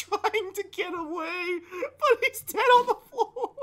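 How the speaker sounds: very high, squeaky mock-tearful wail; hammed-up blubbering over a death; crying. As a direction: let it climb into a laughing wail. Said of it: "I was crying".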